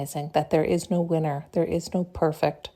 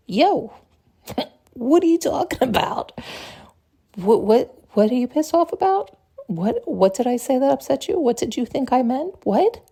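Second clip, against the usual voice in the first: baffled questioning; 5 semitones higher than usual. Acted. Friend confronting an angry partner, baffled and high. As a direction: press them, baffled, with rising whats.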